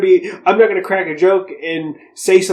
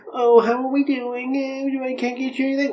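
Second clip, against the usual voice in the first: high, put-on cheery nurse voice, softer; sing-song fake bedside cheer, pitched up; mock chipper nurse; 8 semitones higher, 5 dB quieter than usual. Mocking how a visit with the bosses would sound, high and sugary.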